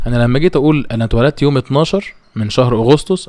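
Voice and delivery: ordinary talk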